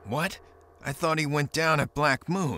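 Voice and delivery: sharp high startled what; shocked. Acted. Kai hearing the traitor is alive, shocked. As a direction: jolt up in disbelief.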